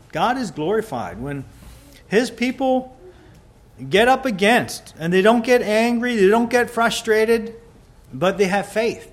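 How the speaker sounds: loud, high preaching at full force; rising pulpit fervour. Acted. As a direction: preach it out to the congregation. Preacher exhorts on faith.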